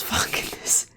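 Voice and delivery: whisper. Real, not acted. Aside to chat, unvoiced.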